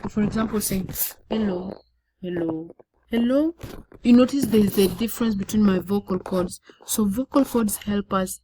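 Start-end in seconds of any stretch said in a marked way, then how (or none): 2.2-2.7 "hello" low and soft. Shown as a demonstration. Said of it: "hello in a low voice". 3.1-3.5 "hello" high and louder with a rise. Shown as a demonstration. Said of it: "in a high pitched voice".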